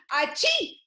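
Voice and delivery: high, sudden pretend "achoo"; mock sneeze. Shown as a demonstration. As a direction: act out a sneeze for the class. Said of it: "When you say".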